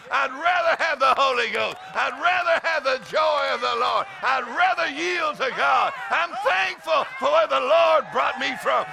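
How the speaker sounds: loud, high preacher's shout building in fervor; full-force preaching. Acted. Preacher testifying to a congregation about the Lord, full-force preaching. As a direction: drive each phrase home at a shout.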